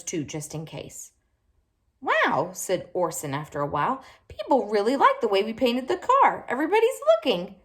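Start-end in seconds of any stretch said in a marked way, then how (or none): 2.0-2.5 put-on high, bright child's wow; amazed little boy; excited wow. Orson marvels at the painted car, excited. 4.3-7.6 high, rising eager chatter, fast at the end; bubbling over with excitement; excited child. Acted. Orson tells Dad everyone admires the car, excited.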